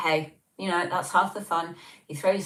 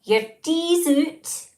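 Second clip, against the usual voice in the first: high, loud, slow Scottish accent; hammed-up Scottish, stretched and pitched way up; 14 semitones higher, 7 dB louder, much slower than usual. Shown as a demonstration. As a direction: call it out in exaggerated Scottish. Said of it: "I should try and do it Scottish".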